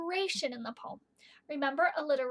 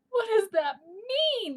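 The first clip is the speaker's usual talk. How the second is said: put-on broad singsong kids theatre; 6 semitones higher, 5 dB louder, slower than usual. Teacher asks the class.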